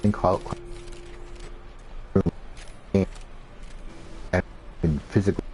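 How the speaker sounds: choppy audio breaking up; glitchy robotic call. Real, not acted. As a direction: voice cutting in and out on a bad connection. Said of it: "Your audio is breaking up a lot".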